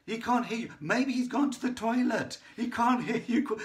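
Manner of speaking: loud, high-pitched imitated jeering; mocking taunt. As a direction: goad them like a heckling prophet. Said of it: "he is mocking them. He is laughing at them".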